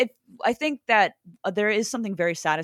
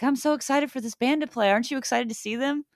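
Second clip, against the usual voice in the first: eager questions pitched up, last one punched; excited fan; 5 semitones higher than usual. Acted. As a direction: play a thrilled fan, bursting at the end. Voicing an excited young fan.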